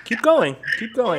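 high, loud goading; taunting. Real, not acted. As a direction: egg him on, mocking. Taunting the scammer to continue.

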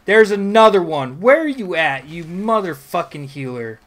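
loud raging at the hidden healer; staged rage. Acted. Gamer hunting the hidden healer, loud theatrical rage. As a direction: hunt him down, shouting. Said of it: "put a little more, you know, theatrics".

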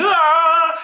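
high, loud burst of a yell; playful yell. Acted. Teacher yells for fun after singing the word, high and loud. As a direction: let out a silly cry.

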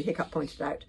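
ordinary delivery, nothing marked

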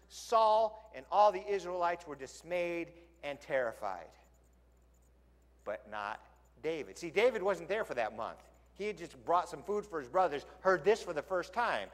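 ordinary delivery

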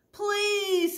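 high, loud tearful plea; crying plea. Acted. Fallen manager begs the staff, crying out. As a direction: beg through tears. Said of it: "he started to cry".

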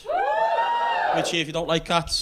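rallying the audience to cheer, shouting slowly; crowd-rousing shout. Real, not acted. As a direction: whip the crowd up, drawn out slowly. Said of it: "Give me a cheer if you like cats".

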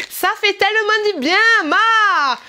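high, loud hammed-up German accent. Vlogger reading out a German product's slogan, exaggerated German accent. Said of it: "nous sommes en Allemagne".

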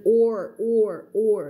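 "or" drawn out with an English R; R-coloured OR. Shown as a demonstration. English teacher isolating a sound for Thai learners, R-coloured "or". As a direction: sound out "or", holding the R. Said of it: "เสียง R มี or".

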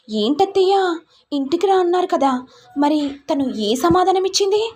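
scheming sister-in-law. Acted.

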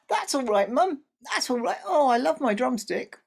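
put-on high, loud brave child's voice; cheerful little boy. A narrator's reading. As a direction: sound brightly content. Good boy accepts cheerfully.

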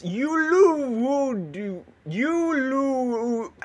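"Ululu" stretched very slowly on long oo vowels; drawn-out attempt. Real, not acted. Gamer struggling with mob name "Ululu", drawn-out attempt. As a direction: sound out "Ululu" as one long wobbling hoot. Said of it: "I can't pronounce this".

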